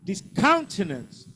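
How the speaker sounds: Discountenance with the beat on "count"; stress on second syllable. Shown as a demonstration. Teacher drilling stress patterns with the class, second syllable of Discountenance stressed. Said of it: "Second syllable".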